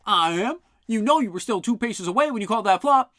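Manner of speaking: high, loud shouting guards; angry quarrel. Acted. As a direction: fight over every move. Guards quarrelling over the game.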